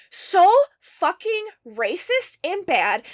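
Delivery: slow, loud, disgusted outburst; real indignation; outraged. Real, not acted. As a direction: spit it out, fed up. Outraged at the book.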